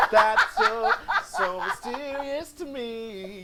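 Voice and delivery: high, slow, laughing mystic voice; mock psychic vision. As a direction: ham up a spooky vision. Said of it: "my That's So Raven moment".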